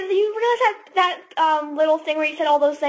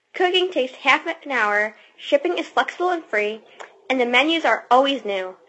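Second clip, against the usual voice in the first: ad read; 4 semitones lower than usual. Acted. Reads ad copy.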